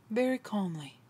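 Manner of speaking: low, hushed aside; very calm. Shown as a demonstration. Preacher describing Jesus's last words, very calmly. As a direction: drop to a soft, low murmur.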